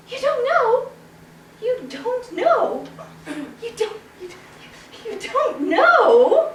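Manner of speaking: high, incredulous, slowing down; shocked disbelief. Acted. Townspeople stunned that the chicken doesn't know, disbelieving. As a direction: stretch the disbelief out. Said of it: "The people were so shocked".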